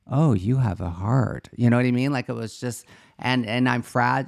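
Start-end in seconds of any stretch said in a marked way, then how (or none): 0.1-1.5 slow, hollow imitation, Oh drawn out on a rising tune; soulless poor soul. Retelling the friend staring at her, slow hollow imitation. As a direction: play a poor soul whose heart was sucked right out. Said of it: "looking at me like".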